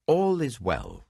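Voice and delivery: slow, lifted call to the king from afar. A narrator's reading. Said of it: "Then Ahimaaz called out to the king".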